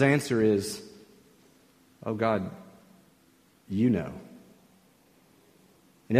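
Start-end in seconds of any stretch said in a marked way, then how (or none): 2.1-4.1 soft, low, humble surrender. Acted. Preacher as the prophet answering God, humbly. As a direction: answer God quietly, lowering the voice. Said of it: "the humility expressed by Ezekiel".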